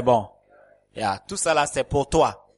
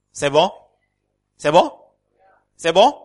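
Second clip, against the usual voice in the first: calling out louder each time, pitch climbing; rising call-outs; 5 semitones higher, 8 dB louder than usual. Real, not acted. Pastor checks if the congregation hears, rising calls. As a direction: push the question up to the back rows.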